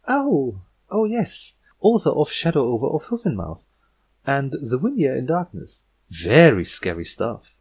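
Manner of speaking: nervous forced cheer. Acted. Twilight forcing enthusiasm at the creepy guest. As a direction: paper over the unease with eager politeness.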